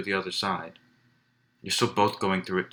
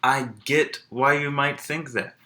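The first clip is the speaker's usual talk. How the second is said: slow, high and pressing, every word weighted; emphatic; 5 semitones higher, slower than usual. Retold or imitated. Teacher imitating John Oliver's emphatic words to camera, slow and high. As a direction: lean into it like talking straight to camera. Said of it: "he even says it very emphatically to the camera".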